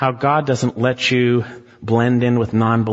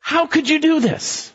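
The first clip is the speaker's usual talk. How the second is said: high, aghast question; shocked sailors; 13 semitones higher than usual. Retold or imitated. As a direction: hurl it in disbelief. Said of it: "And they said to him".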